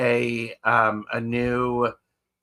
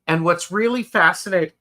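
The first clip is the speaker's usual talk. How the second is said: talking through the hiccups; 6 semitones higher, 4 dB louder, faster than usual. Real, not acted. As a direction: speak with hiccups breaking in. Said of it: "I have the hiccups".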